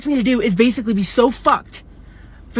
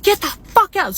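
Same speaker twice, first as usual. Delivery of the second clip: retold scream; 9 semitones higher than usual. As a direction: imitate her screaming, pitched up at normal volume. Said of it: "screaming at me".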